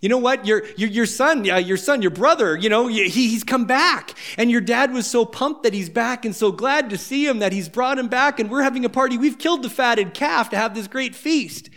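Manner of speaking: bubbling over to tell the big news; excited servant. Acted. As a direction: servant breathless with party gossip. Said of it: "And the servant says".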